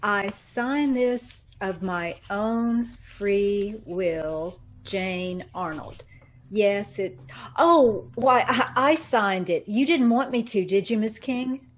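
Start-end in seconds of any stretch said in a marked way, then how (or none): 0.0-6.0 slow, halting read-back of the letter. Acted. Secretary reading back the dictation slowly. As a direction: read each word slowly as you write it.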